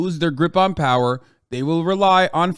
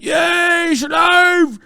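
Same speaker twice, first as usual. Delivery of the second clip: shouted much higher and louder, dragged out slowly, a chanting lad; mocking English football fan; 14 semitones higher, 8 dB louder, much slower than usual. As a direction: ham up a rowdy English fan cheering at the top of his lungs. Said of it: "This is how they speak".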